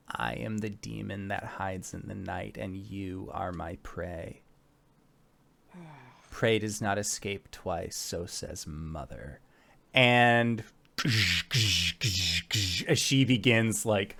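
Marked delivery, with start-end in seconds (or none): 0.1-9.4 low, quiet rumble of a menacing giant; deep, rumbly giant. Acted. Giantess stalking her prey, deep rumbly voice. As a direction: rumble it softly and very low, like a predator. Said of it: "in that, like, kind of deep, rumbly voice".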